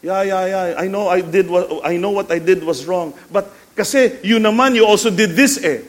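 acted defensive counter-accusation; pointing the finger. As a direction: brush off your fault, then accuse. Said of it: "pointing the finger".

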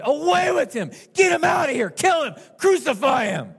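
loud, high mob shouting; harsh scream. Retold or imitated. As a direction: scream for his execution like an angry crowd. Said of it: "They screamed".

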